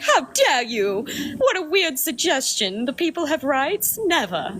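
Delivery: mock-outraged aristocrat in a put-on accent; mock posh accent. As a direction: noble scoffing at democracy, in a hammed-up accent. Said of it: "I don't know what that accent was".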